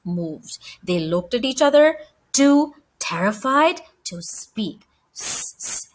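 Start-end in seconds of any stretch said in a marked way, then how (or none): 5.1-5.9 soft unvoiced sss to get attention; whispered hiss. A narrator's reading. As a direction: hiss quietly so no one hears. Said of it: "whispered Keith".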